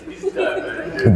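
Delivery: He's diabetic in a high, unvoiced whisper; high airy mock whisper. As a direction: squeak He's diabetic breathily.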